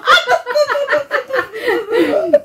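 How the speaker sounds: long high-pitched laugh. Real, not acted.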